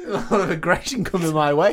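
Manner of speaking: stand-up comic laughing. Retold or imitated.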